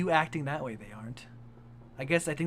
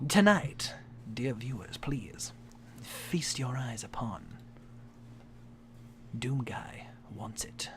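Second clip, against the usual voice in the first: slow, breathy whispered announcement; hushed and slow, building mystery; dramatic hushed intro; 5 semitones lower, 7 dB quieter, slower than usual. Acted. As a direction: whisper it like a spooky show host. Dramatic intro of the story reading.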